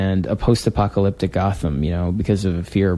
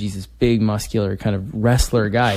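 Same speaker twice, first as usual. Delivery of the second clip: ordinary delivery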